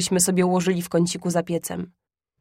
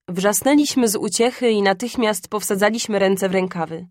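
plain reading voice

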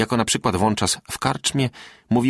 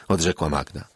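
plain reading voice

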